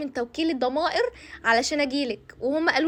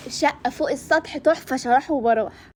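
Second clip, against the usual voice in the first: mock praise. Conscience mocking the future flat.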